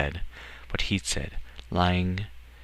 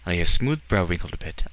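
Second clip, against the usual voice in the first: ordinary delivery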